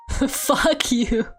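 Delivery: usual speaking voice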